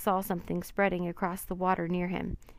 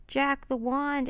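higher, slow, drawn-out yell; 7 semitones higher, slower than usual. Acted. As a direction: stretch the call across the waves. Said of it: "Annie yelled".

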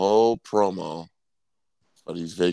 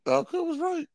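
mock squeaky voice. Mock high catchphrase.

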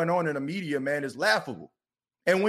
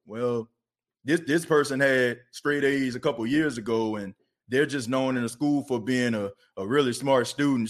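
mock dismissive critic; 7 semitones lower than usual. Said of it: "just imagine people like".

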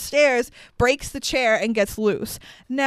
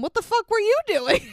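high-pitched, exasperated challenge; indignant retort; 10 semitones higher than usual. Retold or imitated. Host scolds the blaming man, high indignant challenge. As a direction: throw it back, pitched up in disbelief.

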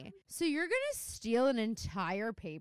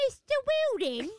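put-on British accent; 9 dB louder than usual. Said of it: "That's not how I usually do it".

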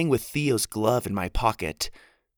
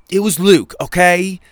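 louder, higher snapped admission; defensive outburst; 7 semitones higher, 11 dB louder than usual. Acted. Jack snapping at Winnie who punched him, loud. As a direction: blurt it out to end the questions.

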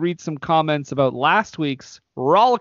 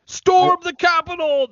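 high, loud hammed-up rally shout; over-the-top fake revolutionary yell; mock rallying cry; 4 dB louder than usual. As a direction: rouse an imaginary mob, tongue in cheek.

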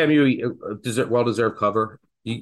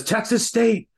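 higher-pitched mock yell of excitement; retold excited scream; 10 semitones higher, slower than usual. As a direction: imitate the guy bursting in with news.

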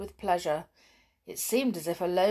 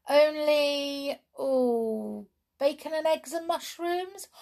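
high-pitched, teasingly hesitant list; cook calling back; 9 semitones higher, 4 dB louder, slower than usual. A narrator's reading. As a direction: call out the menu, stretching each item. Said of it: "said Joanna from the kitchen".

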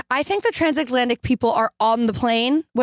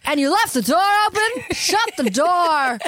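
mock scolding; 5 semitones higher than usual. Acting out own version of the door line, mock scolding. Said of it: "It was like".

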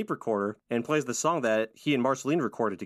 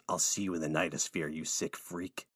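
low taunt; 7 semitones lower, 7 dB quieter than usual. Retold or imitated. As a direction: lower and softer, a menacing sign-off. Finn mocking defeated villain.

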